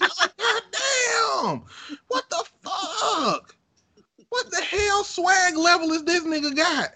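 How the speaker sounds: high, slow amazed wail; amazed cry. Retold or imitated. Watching Obama's shot, a high slow amazed cry. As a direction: stretch out the disbelief. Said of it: "It's like".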